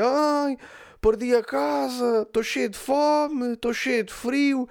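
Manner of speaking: high, slow, drawn-out wail; mock crying. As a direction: imitate a woman wailing in despair. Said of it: "a chorar, a dizer". (imitação de choro lamuriento)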